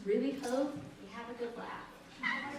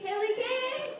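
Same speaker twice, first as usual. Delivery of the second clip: calling backstage; 12 semitones higher, slower than usual. Real, not acted.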